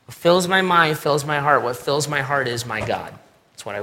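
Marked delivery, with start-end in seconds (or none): none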